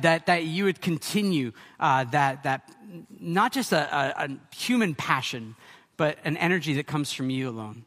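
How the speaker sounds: low, soft, earnest prayer; closing prayer. Real, not acted. Pastor praying for the congregation before the sermon, hushed prayer. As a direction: let the prayer settle down low toward the amen.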